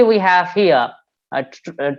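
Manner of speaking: usual speaking voice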